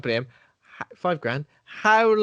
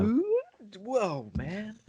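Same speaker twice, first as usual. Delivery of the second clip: dodging traps; 6 semitones higher, 6 dB quieter, much slower than usual. Retold or imitated. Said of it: "so he's like".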